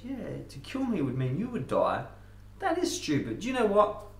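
high-pitched, dawning agreement; frog having a realisation. Acted. Frog is persuaded by scorpion's argument, high-voiced realisation. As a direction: play the frog coming round, voice climbing high.

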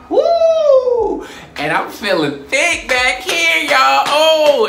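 high, loud whoop, slow and stretched; whooping with joy; high squealing hype; excited whoop. Real, not acted.

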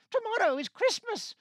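high, loud Grinch yelp; Grinch outburst. A narrator's reading. Grinch grumbling that Christmas is here, high loud yelp. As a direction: squeal it out in disgust.

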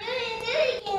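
high, breathy burst of laughter. Real, not acted. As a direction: laugh with delight. Found hiding toddler.

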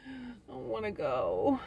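slow, drawn-out reluctant whine. Retold or imitated. Tired woman whining she doesn't want to go, slow. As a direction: drag out the unwillingness like a tired kid. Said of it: "I'm like".

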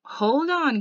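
loud, high cut-in; breaking in. Acted. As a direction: cut him off sharply and loud. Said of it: "Dally broke in".

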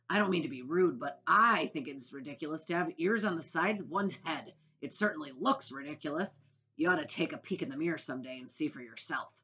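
loud, mocking, emphatic needling; cheeky centipede. A narrator's reading. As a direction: lay into the boy with relish. Plays rude centipede.

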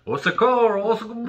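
high, loud play-acted call for help; mock drowning cry. Host pretends to drown in the bathtub, mock high cry for help. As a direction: cry out in French and gurgle under. Playing himself drowning in the tub.

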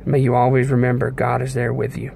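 normal talking voice

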